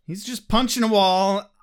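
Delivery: usual speaking voice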